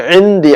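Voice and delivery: عندی with a squeezed throaty ain; bring out ain. Shown as a demonstration. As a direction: stress the opening ain of عندی. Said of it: "عین نکالیے".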